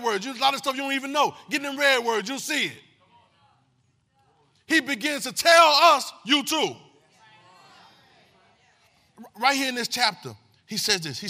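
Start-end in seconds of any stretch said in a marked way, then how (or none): 4.7-6.7 loud, slow, rising preacher shout; full-force preaching cry. Real, not acted. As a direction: belt it to the back pew.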